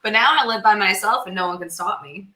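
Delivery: usual speaking voice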